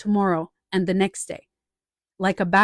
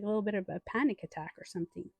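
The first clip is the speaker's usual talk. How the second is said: ordinary talk, nothing marked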